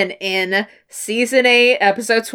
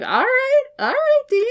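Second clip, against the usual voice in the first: high-pitched, teasing "all right, Dean"; mock approval. Fan grudgingly praising Dean, high teasing approval. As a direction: pat him on the head with your voice. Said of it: "It's like".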